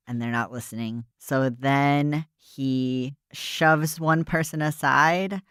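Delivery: ordinary delivery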